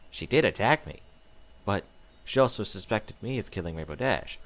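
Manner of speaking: plain reading voice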